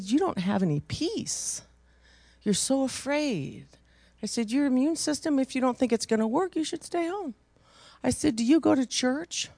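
high, rising preacher's rebuke; preaching. Acted. Preacher tells the congregation about her rebuke, rising high. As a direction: press the point like a preacher.

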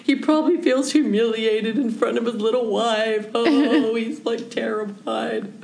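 pitying. Retold or imitated. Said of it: "I'm like".

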